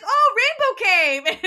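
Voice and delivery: high, loud, gleeful retelling; excited Tyson. Retold or imitated. Tyson telling Percy how he got there, bubbling with excitement. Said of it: "Tyson's so excited".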